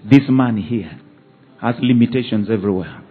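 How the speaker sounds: loud, rising preacher's exhortation; preaching at full force. Acted. Pastor exhorting his congregation, loud preaching. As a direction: drive the message home to the church.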